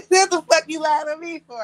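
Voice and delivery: loud, high laughing protest. Real, not acted. Teasing her husband on the live, laughing loudly. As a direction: laugh it out in disbelief.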